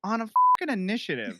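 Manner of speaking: high, fast, indignant gripe; upset, exasperated. Real, not acted. Player bemoaning a nat twenty on initiative, exasperated. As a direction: complain in disbelief at wasted luck. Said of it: "You sound so upset about this".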